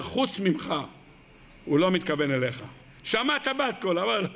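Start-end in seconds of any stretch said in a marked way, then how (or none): 0.0-2.6 much lower, dismissive murmur; the body's low voice. Acted. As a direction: talk him down in a low voice. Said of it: "אבל הגוף".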